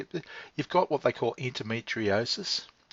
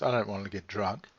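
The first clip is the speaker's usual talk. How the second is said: normal talking voice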